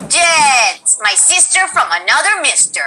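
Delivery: Brazilian character line, a called-out name and a lilting sign-off; light Brazilian accent. Acted. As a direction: voice the Brazilian hero greeting her friend. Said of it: "she says".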